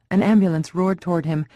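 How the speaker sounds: ordinary delivery, nothing marked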